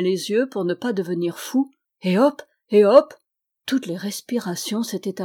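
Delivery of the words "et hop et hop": élan de galop, bien plus fort; et hop. A narrator's reading. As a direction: lance les hop comme un cavalier. Cheval galopant sur la mer. (et hop, lancé avec élan)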